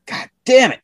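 high, fed-up curse; exasperated. Retold or imitated. Cursing over the unusable truck, high and exasperated. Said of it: "I'm like".

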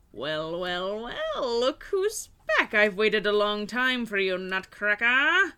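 slow menacing king gloating; villain king voice. Acted. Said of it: "the king says".

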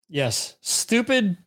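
normal talking voice

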